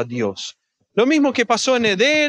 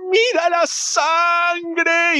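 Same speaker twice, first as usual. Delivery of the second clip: mock desperate cry; 9 semitones higher, much slower than usual. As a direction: stretch it out as a child clinging and begging. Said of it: "se cuelga de su cuello y le grita". (grito suplicante imitado, agudo y muy lento)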